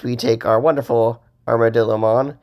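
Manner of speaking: ordinary talk, nothing marked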